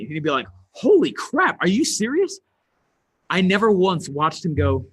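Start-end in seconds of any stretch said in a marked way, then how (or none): 0.7-2.4 shocked. Retold or imitated. Boss hears the speaker's fee, shocked and high. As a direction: sell the disbelief at the price.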